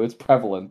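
"prevalent" stressed up front, PREV-uh-lunt; correct pronunciation. Shown as a demonstration. Correcting Dan's word of the day, "prevalent" as PREV-uh-lunt. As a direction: put the weight on PREV in "prevalent". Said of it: "I have to tell you".

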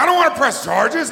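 high, loud hasty retreat; backing down. Retold or imitated. Handcuffed man to the cops, high loud backing down.

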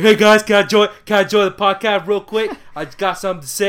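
loud, high-pitched talking dog; put-on voice of a dog calling out; dog's voice. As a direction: shout it as an eager dog butting in.

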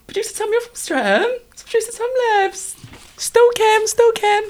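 very high, fast excited call to the producer. Real, not acted. Calling to the producer about a Streatham listener, high excited call. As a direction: call out eagerly.

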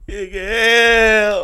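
loud wailing fake cry; laughing through a forced cry. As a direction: wail his name, crying. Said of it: "Eu consegui chorar".

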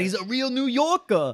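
hammed-up New York accent, high and loud. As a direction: belt it out as a caricature New Yorker. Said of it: "he talks just like that".

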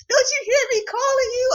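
high mock mother calling out; imitating mom. Mom yelling upstairs at her gaming son, high mock nag. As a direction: squeak it out like a nagging mom.

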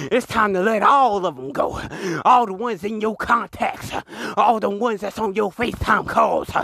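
preaching. Acted. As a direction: preach it to the congregation.

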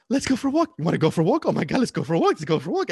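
loud, high, gushing hype; excited dog owner. Acted. Owner inviting the dog for a walk, excited. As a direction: work the dog up with eager repeats. Imitating owners hyping up their dogs.

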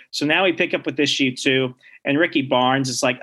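ordinary talk

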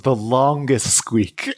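slow, stretched-out "the longest squeak"; drawn-out. Shown as a demonstration. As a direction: draw out "longest" like the squeak itself. Describing chair squeak.

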